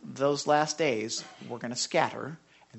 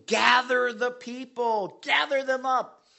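proclaiming shout; 8 semitones higher, 3 dB louder, slower than usual. Acted. Preacher calls out the verse.

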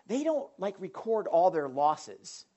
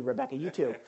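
normal talking voice

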